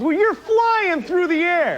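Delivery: high, slow, awestruck; amazed. Real, not acted. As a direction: marvel at the miracle of flight. Marveling again.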